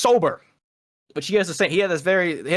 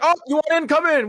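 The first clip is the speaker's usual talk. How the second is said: high, airy open-door invite; mock breezy welcome; 9 semitones higher than usual. Mocking how the team let anyone in, breezy high invite. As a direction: wave them in carelessly.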